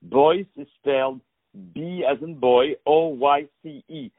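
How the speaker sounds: put-on letter by letter, B as in boy, O Y C E; spelling it out. Shown as a demonstration. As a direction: spell out B O Y C E clearly. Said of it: "Let me spell it for you".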